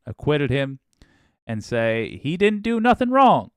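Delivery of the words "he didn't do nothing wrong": put-on lampooning voters in an overdone Southern accent; mock Southern accent. Host mocking Texas voters, loud high Southern accent. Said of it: "I don't know why I used that accent".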